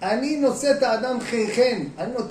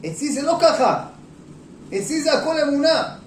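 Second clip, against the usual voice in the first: slow, louder, higher insistence; firm loud answer; 7 semitones higher, 6 dB louder than usual. Acted. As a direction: hammer home that faith decides. Believer answers the president firmly. (תשובה נחרצת, איטית ורמה)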